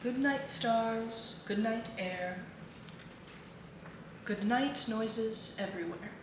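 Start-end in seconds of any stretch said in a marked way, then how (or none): none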